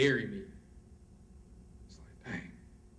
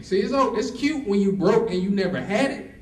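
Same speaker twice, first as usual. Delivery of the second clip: ordinary delivery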